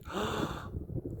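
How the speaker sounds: sharp high gasp; amazed gasp. Retold or imitated. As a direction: gasp in wonder. Mock amazed gasp at finding others.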